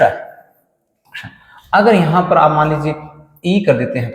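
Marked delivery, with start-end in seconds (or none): 0.0-1.2 क्ष stretched very slowly and softly as a k fused with sh; drawn-out ksha. Shown as a demonstration. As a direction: hold क्ष long and soft. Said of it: "ये बन जाता है क्ष".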